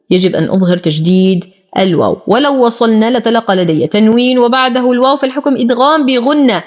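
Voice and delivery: ordinary talk, nothing marked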